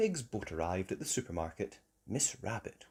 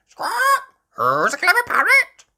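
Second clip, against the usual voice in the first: high, loud, squawking parrot; squawk, then parrot it back shrilly; parrot mimicry; 12 dB louder than usual. Polly copying Granny Pig, squawky parrot voice. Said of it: "Polly copied".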